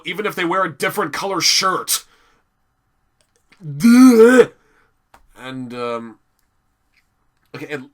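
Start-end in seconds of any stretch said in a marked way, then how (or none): 3.6-4.5 much higher, louder sarcastic blurt; mocking duh. Real, not acted. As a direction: scoff at the obvious. Mocking the obvious point.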